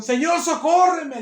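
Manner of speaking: loud, high, slow plea; crying out. Acted. The mother begging the Lord for help, loud anguished cry. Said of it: "le dice gritando porque tiene consternación".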